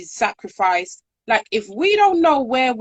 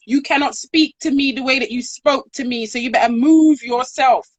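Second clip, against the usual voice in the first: higher, louder, fast imitation of an indignant woman; retold indignant outburst; 5 semitones higher, 3 dB louder than usual. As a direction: throw it back at her, affronted and quick. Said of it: "she just turned around and she said".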